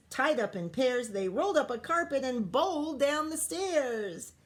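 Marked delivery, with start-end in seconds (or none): none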